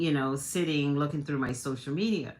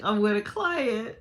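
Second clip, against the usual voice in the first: flustered; 7 semitones higher, 4 dB louder than usual. Retold or imitated. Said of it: "I go, like".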